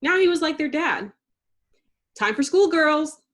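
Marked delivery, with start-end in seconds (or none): 2.1-3.2 calling out. A narrator's reading. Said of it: "the professor called".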